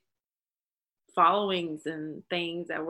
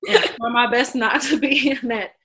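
laughing; 5 semitones higher, 10 dB louder than usual. Real, not acted. Laughing while explaining.

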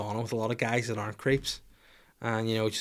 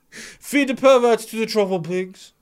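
much higher, louder, slowed-down proclamation; gleeful exclamation; 10 semitones higher, 8 dB louder, slower than usual. Real, not acted. Host relishing his punchline to the listeners, loud and high. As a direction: declare it like a gleeful verdict.

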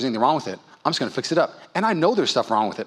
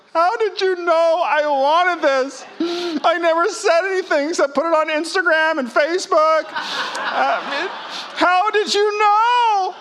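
much higher, slow squealing delight with laughter; delighted surprise; 13 semitones higher, slower than usual. Pretend. Gift receiver at Christmas, high delighted squeal. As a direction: gush like you just opened the perfect gift.

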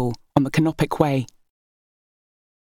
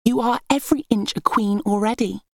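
sweetly; 7 semitones higher than usual. A narrator's reading. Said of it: "said sweetly".

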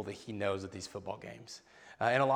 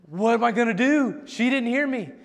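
loud, high-pitched frantic wail; mock panic, pitched way up; frantic child; 10 semitones higher, 8 dB louder than usual. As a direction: imitate a panicked small boy pleading loudly. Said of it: "looks at me all frantic".

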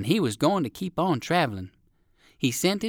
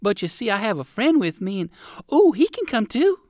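very high, louder gushing chick; eager to include a friend; chirpy chick girl; 10 semitones higher, 5 dB louder than usual. Acted. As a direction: Arabella welcomes Fido to her party, very high. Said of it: "spoke Arabella".

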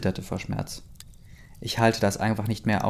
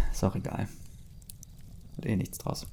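muttered aside; 10 dB quieter than usual. Real, not acted. Resigned aside after rambling.

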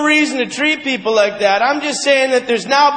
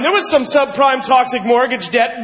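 ordinary talk, nothing marked

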